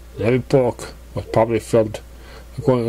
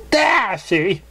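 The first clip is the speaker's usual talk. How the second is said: voiced Pinkie; 9 semitones higher than usual. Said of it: "said Pinkie Pie".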